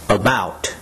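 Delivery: "About" with the weight on "bout"; light "A", accented "bout" in "About"; second-syllable stress. Shown as a demonstration. Teacher modelling word stress, "About" stressed on its second syllable. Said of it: "second syllable is to be accented".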